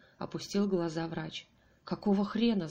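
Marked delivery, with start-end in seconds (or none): none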